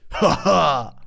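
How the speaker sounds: short, flat, deadpan two-beat laugh; a dry, clipped "ha ha"; Dom's laugh. Retold or imitated. Imitating a friend's curt laugh for a podcast cohost. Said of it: "he laughs like this".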